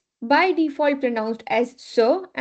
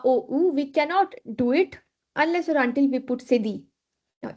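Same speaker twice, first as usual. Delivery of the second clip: ordinary talk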